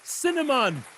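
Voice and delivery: put-on loud, high Jamaican-accented punchline. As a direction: push the Jamaican accent hard. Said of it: "What do you call a Jamaican bagel".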